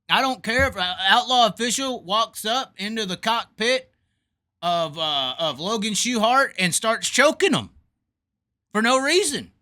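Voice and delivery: heated rant. Real, not acted. Heated rant, rising pitch.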